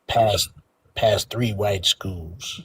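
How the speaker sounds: very slow, low grandpa voice; old man voice. Pretend. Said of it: "an old man voice".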